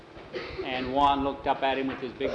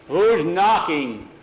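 gruff call; 4 semitones higher, 8 dB louder, much slower than usual. Retold or imitated. Gruff Jose answers door.

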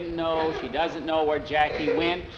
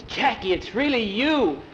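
raised pitch, delighted disbelief; amazed; 6 semitones higher than usual. A narrator's reading. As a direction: exclaim it higher, in astonishment. Preacher amazed at man's return.

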